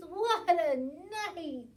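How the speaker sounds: loud, slow cry of "What a night"; mole exclaiming. Acted. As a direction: wail "What a night!" loudly. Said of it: "Mole mutters".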